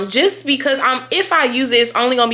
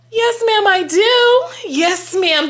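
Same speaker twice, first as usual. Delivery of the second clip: much higher, louder squeal of yes; eager excited yes; 11 semitones higher, 4 dB louder, slower than usual. Real, not acted. Accepting the cousin's gift on FaceTime, thrilled and squealing. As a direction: grab the offer, thrilled.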